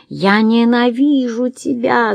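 a lightly shrieked hatred; визг. A narrator's reading. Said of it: "завизжала Бланш". (протяжный визг, выше обычного)

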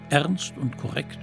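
ordinary delivery

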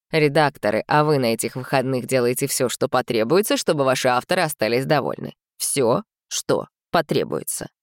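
low, ominous boss warning; a stern boss voice in her head. Retold or imitated. (строгий низкий голос начальницы)

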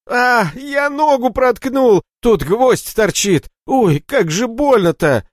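high, loud, slow cries of pain; yelling in sharp pain. Acted. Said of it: "раздался крик боли. Орал Борщок".

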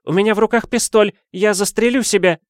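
high, loud, fast hysterical woman; an overwrought lady shrieking through the door. Acted. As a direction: rattle it off in a high panicky threat. Hysterical lady threatens to shoot.

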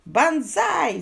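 high, loud victory cry; triumphant shout. A narrator's reading. Suitor forgiven by his sweetheart, triumphant cry. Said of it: "закричал Хьюго".

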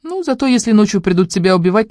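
plain reading voice

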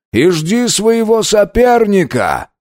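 high, slow barked order; commanding shout. A narrator's reading. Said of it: "бросил один из верховных".

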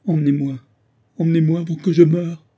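anguished pleading outburst; desperate cry. A narrator's reading. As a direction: beg to be taken away, pitch climbing. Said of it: "s'écria-t-elle".

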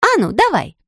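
high, provoking dare; daring. Acted. Blonde daring the cop, high and provoking. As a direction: come on, try it, pitched high. Sandy goads Jane.

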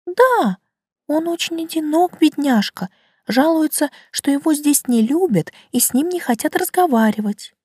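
high, small plant voice; light, high talking flower; violet voice. Acted. Violet Lina speaks.

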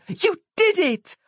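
very high, slow jubilant yell; yelling back. A narrator's reading. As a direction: stretch out a high yell of praise. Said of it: "Roz yelled back at him".

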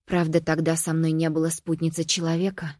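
ordinary delivery, nothing marked

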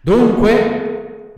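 high, impatient villain's demand; threatening villain. A narrator's reading. Assassins question Pinocchio, threatening villain voice. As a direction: demand an answer in a put-on villain voice.

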